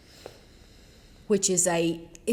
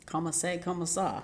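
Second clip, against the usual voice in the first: bad French accent; 5 dB quieter, slower than usual. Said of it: "Is that really bad French?".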